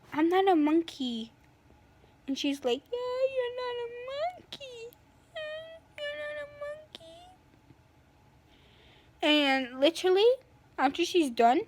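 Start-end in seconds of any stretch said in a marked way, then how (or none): 2.9-5.0 high, soft sing-song put-on voice; mock them in a thin high voice; mocking echo. [name] mocking Helena's protest, high sing-song echo. 5.4-7.2 very high, very soft squeaky sing-song; mocking echo. [name] taunting Helena, tiny high mocking voice.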